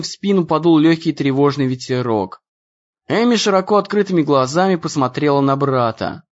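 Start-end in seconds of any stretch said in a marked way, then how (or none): none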